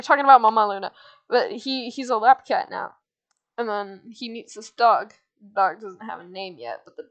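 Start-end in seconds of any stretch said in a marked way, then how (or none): none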